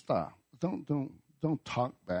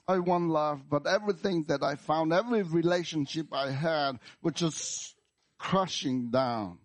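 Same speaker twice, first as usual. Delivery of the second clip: raised, pained protest sinking at the end; anguished complaint; 6 semitones higher than usual. Acted. Wounded believer telling God about crushed relationships, anguished complaint. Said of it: "you bring out your anger at God".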